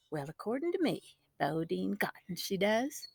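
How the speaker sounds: quiet. A narrator's reading. Brother voiced quietly.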